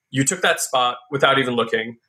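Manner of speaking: usual speaking voice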